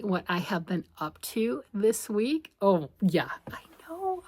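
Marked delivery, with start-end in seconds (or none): 2.6-4.2 whisper. Real, not acted. Aside whispering to viewers.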